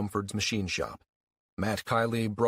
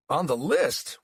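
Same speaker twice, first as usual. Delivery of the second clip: incredulous echo; 5 semitones higher, 3 dB louder, slower than usual. Acted. Jim echoes the sheriff's words in disbelief, rising. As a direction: question rising on list.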